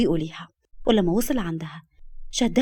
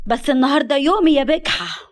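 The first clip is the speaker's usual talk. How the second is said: higher, louder jealous yell; shout; 5 semitones higher, 6 dB louder than usual. A narrator's reading. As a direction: scream it at the shameless rival. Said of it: "فصرخت فيها". (صرخة غيرة عالية)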